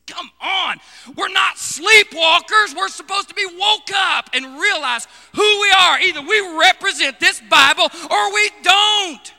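thundering sermon call to wake up; fiery preaching. Acted. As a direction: preach it hard to wake the church. Preaching at full force.

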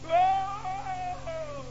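high, soft fake yelp; faked cry of pain. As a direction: fake a fall to stop the beating. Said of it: "I acted like I fell".

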